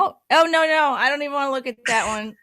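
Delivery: loud, high "oh no" of real disgust; loud recoil. Real, not acted. Reacting to a Zoom mask filter, loud recoil. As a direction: push the mask away with your voice.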